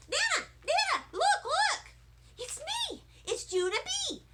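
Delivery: put-on squealing little-girl excitement; thrilled shout. A narrator's reading. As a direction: call out to Nana thrilled. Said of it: "I shouted".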